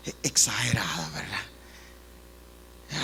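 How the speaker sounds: low, soft confiding whisper; whispered aside. Real, not acted. Preacher whispering an aside to the congregation. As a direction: lean in and whisper it. Aside about clothing sizes.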